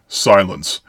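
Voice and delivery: plain reading voice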